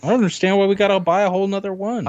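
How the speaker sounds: high, quick parent's whine; complaining parent. Acted. Parent balking at another Nintendo console purchase, high-pitched complaint.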